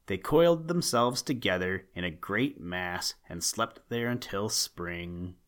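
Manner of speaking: plain reading voice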